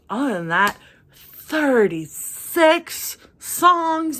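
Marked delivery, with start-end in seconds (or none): 1.1-4.2 exasperated. Real, not acted. Exasperated at the album's length.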